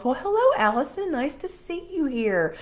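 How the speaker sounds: high, loud, delighted hello; delighted greeting. Real, not acted. Host spots Allison joining from Scotland, delighted greeting. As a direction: pitch leaps up in happy welcome. Greets a newly arrived viewer.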